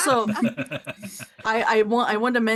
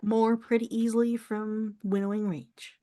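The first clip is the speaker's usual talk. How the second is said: normal talking voice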